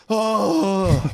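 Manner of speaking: put-on high, loud disappointed kid sigh; kids' disappointment. Dad imitating his kids hearing he's leaving again. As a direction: sound crushed like a small child. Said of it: "And they went".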